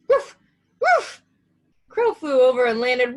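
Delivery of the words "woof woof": high, loud yapping woofs; barking. A narrator's reading. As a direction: bark like a little dog. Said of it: "barked Little Scarecrow".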